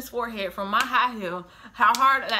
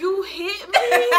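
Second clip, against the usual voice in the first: high, slow, laughing disbelief; stunned and half laughing; incredulous you hit me; 9 semitones higher, 6 dB louder, much slower than usual. Retold or imitated. Realizing he hit her.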